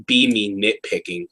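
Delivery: normal talking voice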